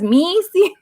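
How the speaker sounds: put-on a touch of a Spanish accent on Missy; Missy with mock Spanish vowels; Missy in a light Spanish accent; Spanish-accented Missy, high. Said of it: "getting her Spanish accent".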